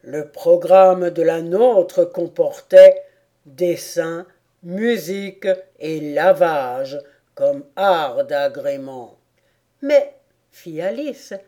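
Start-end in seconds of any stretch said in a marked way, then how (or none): 0.0-9.2 slow, proud turtle voice; tortue fière. A narrator's reading. 9.8-10.1 put-on high-pitched little girl; Alice perplexe. A narrator's reading.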